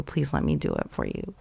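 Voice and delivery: soft unvoiced whisper; whispered plea. Speaker begs crying woman to accept gift, whispering. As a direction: breathe it gently to her.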